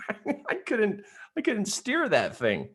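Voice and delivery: high-pitched, laughing through the words; amused at your own helplessness, voice riding up. Real, not acted. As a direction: laugh while confessing the mishap.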